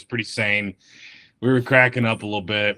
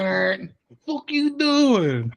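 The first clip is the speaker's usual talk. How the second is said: mock road rage; 9 semitones higher, slower than usual. Imitating angry driver.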